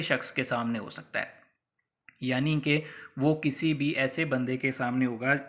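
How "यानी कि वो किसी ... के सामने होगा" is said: usual speaking voice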